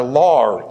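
"law" with an R on the end; Boston-style "law", closing on R; Boston intrusive R. Shown as a demonstration. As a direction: say "law" as "lawr". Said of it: "they put an R in law".

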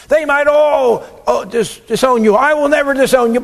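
high, loud indignant protest; protesting Peter. Acted. Peter insisting to Jesus he won't deny him, loud and high. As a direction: insist hotly you'd never betray him.

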